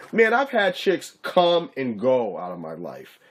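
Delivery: ordinary talk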